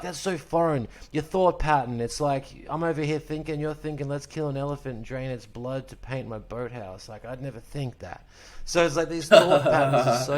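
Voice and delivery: a hint of an actor's line read; quoting Kiss Kiss Bang Bang with mild amusement; Val Kilmer impression. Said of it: "It's like fucking Val Kilmer in Kiss Kiss Bang Bang".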